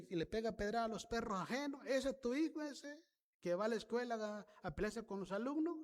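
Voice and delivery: a hint of a gossiping accuser listing the boy's damage; mock accusing neighbour. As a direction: play the neighbour pointing out the troublemaker. Neighbours complaining about a bad son.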